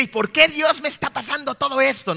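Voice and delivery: loud, high-pitched acted protest to God; angry complaint. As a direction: act out indignant questioning of God. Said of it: "con un corazón de ira". (reclamo airado fingido, en voz alta y aguda)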